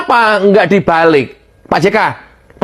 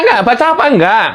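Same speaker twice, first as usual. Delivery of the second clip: ordinary talk, nothing marked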